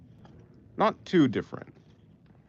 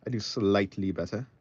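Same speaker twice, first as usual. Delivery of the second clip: put-on British accent, lower and softer; random British accent; 5 semitones lower, 4 dB quieter than usual. Streamer echoing own remark about tutorials, in a random British accent. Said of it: "random British accent makes me cringe".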